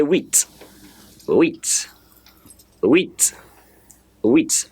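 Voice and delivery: "wheat" with a w glide into ee; teacher drilling "wheat" one word at a time; huit for repetition. Shown as a demonstration. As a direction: say "wheat" as separate models, pausing after each. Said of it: "Let me hear you say wheat".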